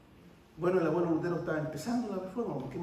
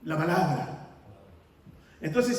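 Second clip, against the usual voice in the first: ordinary talk, nothing marked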